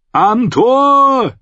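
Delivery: high, long-drawn shout; screamed alarm. A narrator's reading. Someone warns of attack at gate, screamed. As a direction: yell a warning along the wall. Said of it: "schrie jemand".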